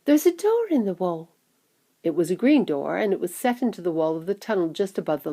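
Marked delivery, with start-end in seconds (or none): 0.0-1.3 excited cry. A narrator's reading. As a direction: point out a discovery in a high voice. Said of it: "cried Charlie".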